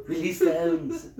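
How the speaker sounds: high, laughing Mr. Burns; slow sinister boss through laughter. Retold or imitated. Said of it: "he would say something like".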